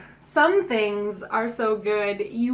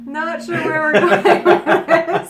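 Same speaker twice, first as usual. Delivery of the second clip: laughing; 4 semitones higher, 9 dB louder, slower than usual. Real, not acted. Cracking up at cohost's joke.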